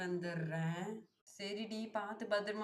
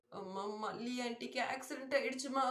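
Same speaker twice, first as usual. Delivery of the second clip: higher, tearful report of the accident; crying; 4 semitones higher than usual. Acted. Daughter telling her mother about the accident through tears. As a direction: sob out the bad news.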